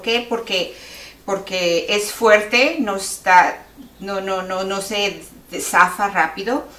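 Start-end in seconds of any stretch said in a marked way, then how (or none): none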